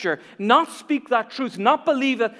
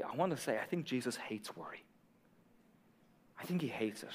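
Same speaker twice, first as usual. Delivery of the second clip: hushed confession; 9 semitones lower, 18 dB quieter than usual. Real, not acted. Pastor softly telling the congregation Jesus hates worry. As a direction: drop right down, soft and intimate. Pastor drops to near hush.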